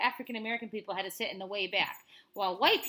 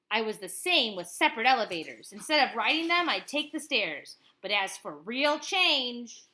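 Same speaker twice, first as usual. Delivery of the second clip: lively read-aloud of a picture book; reading to a child; 8 dB louder than usual. Acted. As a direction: tell it like a bedtime story to a child. Parent reads picture book aloud.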